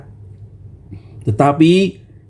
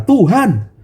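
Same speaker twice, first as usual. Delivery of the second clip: high, earnest plea of an impulsive man; eager Peter; 12 semitones higher than usual. Acted. Peter calling to Jesus from the boat, eager. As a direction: beg to come out on the water. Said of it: "Petrus itu langsung berkata".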